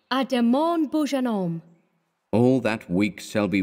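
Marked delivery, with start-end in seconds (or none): 0.1-1.6 very high voice of the model; model's cry. A narrator's reading. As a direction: call out in a high, put-on model's voice. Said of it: "kissed her hand to him and cried".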